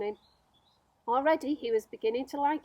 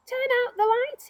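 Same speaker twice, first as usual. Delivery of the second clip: much higher, louder call; raised voice; 13 semitones higher, 6 dB louder than usual. Acted. Centipede raising his voice to the glowworm, high and loud. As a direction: call it up to the ceiling. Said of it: "raising his voice".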